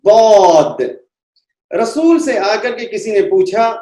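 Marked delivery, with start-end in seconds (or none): 0.0-0.8 बौद said full and rounded, louder; the right way after the mistake; correct heavy dwad. Shown as a demonstration. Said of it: "गलत तलफ्फुज है".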